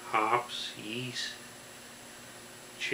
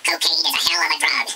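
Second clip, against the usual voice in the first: loud, high, fast catchphrase; fast comic repeat; 6 semitones higher, 8 dB louder, much faster than usual. Acted.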